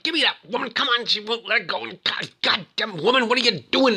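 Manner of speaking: high-pitched mock exasperation; hammed-up frantic impatience; exasperated. Voicing Logan fumbling, snapping at woman.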